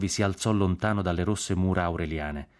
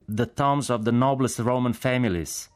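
slow, raised-pitch call in English; shouted answer; 5 semitones higher than usual. A narrator's reading. Jack shouts back to the general, shouted answer. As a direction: shout it slowly so it carries. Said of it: "gridò Jack".

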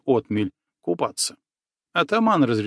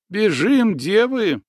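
frightened cry; 7 semitones higher, 3 dB louder, slower than usual. A narrator's reading. Frightened girl urges flight.

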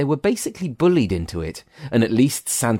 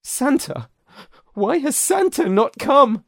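high, slow, trembling little voice; a high, frightened squeak; 3 dB louder, slower than usual. A narrator's reading. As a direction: quaver it out, high and scared. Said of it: "James trembled".

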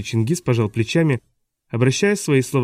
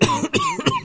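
acted coughing fit; long cough; 3 dB quieter than usual. A narrator's reading. Newcomer's question broken by coughing, acted fit. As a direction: break off into repeated coughs. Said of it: "Голос прервался долгим кашлем".

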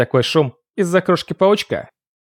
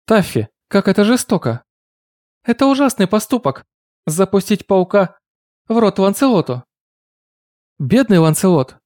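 put-on high, shocked accusing girl voice; 8 semitones higher than usual. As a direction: scold the cat in outrage. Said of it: "насели на меня с обвинениями".

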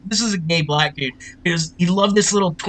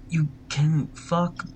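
slow, hushed mock PA announcement; loudspeaker announcement; 2 semitones lower, 10 dB quieter, slower than usual. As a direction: lightly imitate a voice over the loudspeaker. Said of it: "loudspeaker, you hear".

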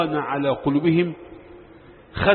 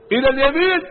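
a raised call up high to the flock; shepherd's call; 7 semitones higher, 3 dB louder, faster than usual. Retold or imitated. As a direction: call the sheep to the right. Shepherd calling to the sheep.

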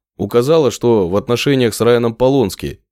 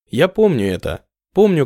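plain reading voice

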